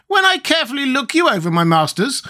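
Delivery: very high squeaky creature; high-pitched squeaky character; Gump's squeaky voice. A narrator's reading. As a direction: squeak it out, far above your own voice. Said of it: "continued the thing".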